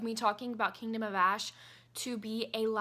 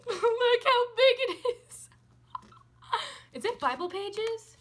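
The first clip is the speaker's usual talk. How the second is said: higher, louder, slow and awed; amazed squeal; 11 semitones higher, 8 dB louder than usual. Real, not acted. Showing viewers the huge book, amazed. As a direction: marvel at how huge it is.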